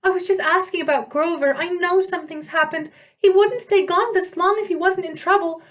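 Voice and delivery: high, pleading nymph voice; a worried girl near tears; tearful dryad. A narrator's reading.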